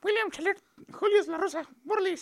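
sped-up squeaky chipmunk voice. Pretend. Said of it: "Más rápido, para que se escuche como ardilla".

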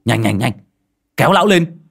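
shouted order in a panic; urgent call. A narrator's reading. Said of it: "Cậu Tẩy hô lên".

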